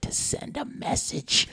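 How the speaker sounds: slow menacing whisper. Retold or imitated. Mom explaining why she hit him, slow whisper. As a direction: breathe it out slowly, coldly. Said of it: "She said".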